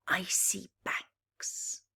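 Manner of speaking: ordinary delivery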